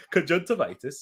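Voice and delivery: soft Geordie pronunciation demo; Geordie accent. Shown as a demonstration. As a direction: say it softly as if Geordie. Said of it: "it comes out Geordie".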